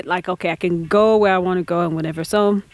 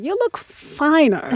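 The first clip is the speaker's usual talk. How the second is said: imitating a tactfully hesitant husband, drawn out and higher; polite euphemism; 5 semitones higher, much slower than usual. Husband back from six months away, politely hinting at weight gain. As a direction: draw it out with careful, mock politeness. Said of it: "his polite way of saying".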